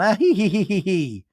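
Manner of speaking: high mock "hee hee" titter; imitated giggling. Acting out the girls all laughing, mock giggle. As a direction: fake a group giggle, high then falling. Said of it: "they're all laughing and".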